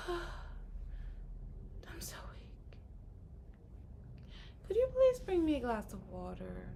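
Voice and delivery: breathy, drawn-out invalid whisper; sighing fake-frail plea; mock weak whisper. Shown as a demonstration. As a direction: overplay being too weak to ask. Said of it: "But if you say".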